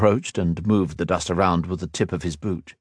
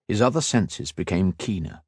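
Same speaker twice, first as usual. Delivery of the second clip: plain reading voice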